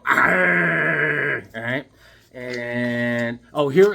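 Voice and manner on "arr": long drawn-out imitated snarl; vampire snarl. Showing off his vampire drawing, imitated vampire snarl. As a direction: growl out a sustained "arr" as a vampire. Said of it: "here's a vampire going".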